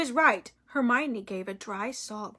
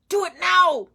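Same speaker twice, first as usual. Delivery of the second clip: inner voice urging; 6 semitones higher, 6 dB louder than usual. A narrator's reading. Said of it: "said a voice in his head".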